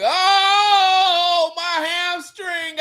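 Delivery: high, slow hammed-up hamstring whine; milk the fake injury slowly. Player faking injury in the film room, high mock whine.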